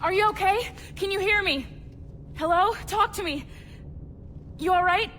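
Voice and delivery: high, loud anxious questions; urgent calling. Acted. Rescuer checking on drugged victim, urgent and loud. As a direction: call out to rouse someone unresponsive.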